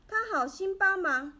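ordinary delivery, nothing marked

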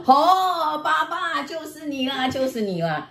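high, drawn-out gotcha, dropping at the end; imitated accusing. Child catching dad out over swearing, imitated accusing. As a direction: call out the culprit in mimicry.

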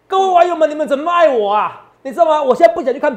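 excited call-out. Real, not acted. Host playfully calling out to his online fans, excited and loud. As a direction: throw it out to the online crowd with glee.

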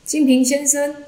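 high, sustained hailing shout; loud call. A narrator's reading. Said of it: "仙崖禅师再大喊".

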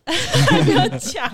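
slow, laughing and high; giggling before the story even starts; amused protest. Real, not acted.

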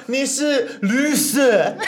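high, very slow sing-song taunt through laughter; taunting kids. Retold or imitated. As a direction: tease like a little kid on the playground. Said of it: "他们就会这样说".

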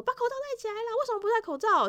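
high, sharp mock scold from a crowd of kids; scolding kids' shout. Classmates ganging up on the maskless kid, shrill mock scold. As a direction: gang up and nag the classmate to mask up. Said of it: "有一点点凶的口气出来".

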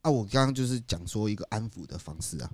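ordinary talk

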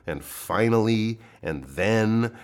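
"and finally", "and then" slowed and leaned on; stressed list ending. Shown as a demonstration. Teacher flags the list's end, "finally" and "then" stressed. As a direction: signal the last item with a weighty "finally", "then". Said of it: "it's really good to stress".

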